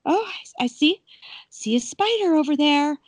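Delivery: high, wavering frightened voice; high shaky mock fear; scared guest. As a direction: imitate her fright at a spider. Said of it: "She's like".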